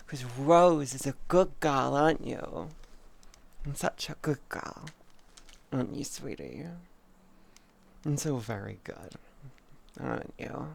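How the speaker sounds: praising Rose like a good puppy; pet talk. Pretend. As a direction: coo like talking to a good dog.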